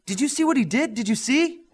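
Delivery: Alex bursting to his parents, excited. A narrator's reading. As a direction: gush it out in a hurry. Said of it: "Alex said quickly".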